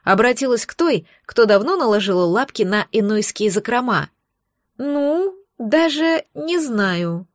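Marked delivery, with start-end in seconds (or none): 4.8-7.3 slow, drawn-out bored whine; bored teenager. Acted. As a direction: stretch it out like a sulky teen. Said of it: "занудным голосом протянула помощница".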